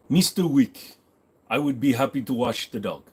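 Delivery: careful put-on accent of a formal concierge; a tentative go at a foreign concierge accent; attempted Charon accent. Concierge offers Mister Wick dog care, attempted Charon accent. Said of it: "Can't make a Chiron accent".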